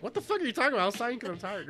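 mock indignant outburst. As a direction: act out an offended stranger snapping back. Imagined angry stranger snaps back.

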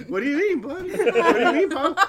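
high, loud, laughing feigned innocence; mock innocent. Son acting clueless to his dad, laughing and loud. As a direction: play dumb while laughing.

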